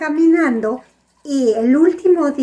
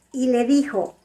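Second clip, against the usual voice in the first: plain reading voice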